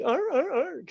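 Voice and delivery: very high barking arr; squeaky seal-like arr sounds; seal barks. Shown as a demonstration. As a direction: bark like a punched seal. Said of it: "fine line between".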